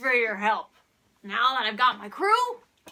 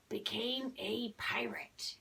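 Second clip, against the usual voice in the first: hushed whisper, slower; 4 semitones lower, 11 dB quieter than usual. Acted. Host whispers the mystery to young viewers. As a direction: lean in and whisper it.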